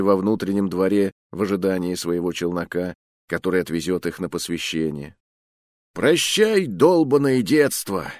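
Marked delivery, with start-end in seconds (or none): 5.9-8.1 high, drawn-out exultant cry; wild sigh of release. A narrator's reading. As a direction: sing out a goodbye like a man possessed. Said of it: "вздохнул Хорвилл, словно одержимый".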